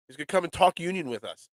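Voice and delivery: ordinary talk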